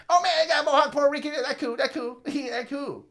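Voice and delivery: high, fast, excited hustler. Retold or imitated. Little hustler excited about a Mohawk Puerto Rican.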